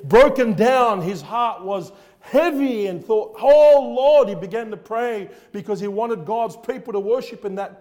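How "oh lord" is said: loud, high cry of prayer; imploring cry. Retold or imitated. Preacher voicing Nehemiah beginning to pray, imploring cry. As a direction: call out to God from a heavy heart.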